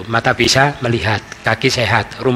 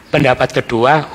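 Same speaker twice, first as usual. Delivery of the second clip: ordinary delivery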